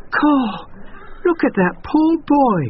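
put-on very high girlish exclamation; pitying girl. A narrator's reading. Girl at the gate pitying the son. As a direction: cluck with pity. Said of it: "one of the girls said".

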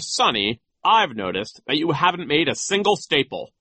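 high, loud, scornful scolding; nasty vice principal. A narrator's reading. Vice principal scolding three failing students, nasty. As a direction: berate them in a high, haughty voice. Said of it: "nasty, mocking voice".

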